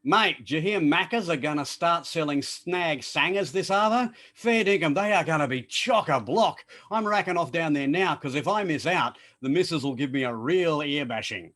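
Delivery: a broad Australian yarn to a mate; quick, broad Australian chat; broad Aussie slang. Shown as a demonstration. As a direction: rattle it off like a true-blue bloke. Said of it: "let's see how you go with this".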